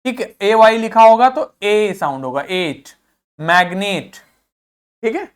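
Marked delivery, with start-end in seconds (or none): none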